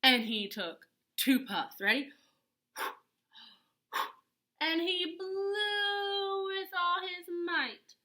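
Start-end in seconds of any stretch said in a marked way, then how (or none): 4.6-7.9 higher and slow, drawn out; dramatic build. A narrator's reading. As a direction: stretch it like a huge breath. Narrator acts the big blow.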